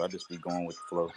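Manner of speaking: usual speaking voice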